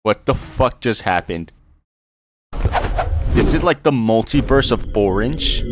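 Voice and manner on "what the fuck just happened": ordinary talk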